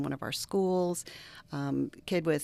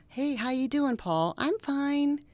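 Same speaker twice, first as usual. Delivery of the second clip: fake cheerful greeting; 9 semitones higher, 3 dB louder, slower than usual. Faking fine while hurt.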